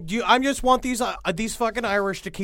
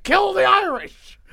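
mock rally shout; 11 semitones higher, 3 dB louder, slower than usual.

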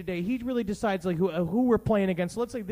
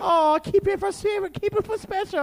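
high, hammed-up Chinese accent; mock Chinese accent; 9 semitones higher, 4 dB louder than usual. Character guarding his lucky wonton, high hammed-up Chinese accent. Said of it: "how much I do Hong Kong".

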